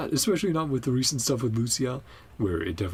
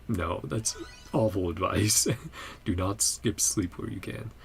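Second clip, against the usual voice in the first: usual speaking voice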